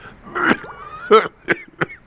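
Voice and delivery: put-on hacking drunken cough; raspy coughing fit; acted cough. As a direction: cough it out like a sick man. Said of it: "How long have you had that cough?".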